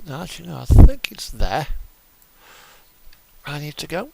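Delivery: usual speaking voice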